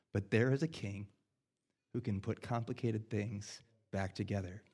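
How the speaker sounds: put-on soft, hushed comfort to a crying child; dad soothing his three-year-old, quietly tender; tender reassurance. Father comforting crying son, retold.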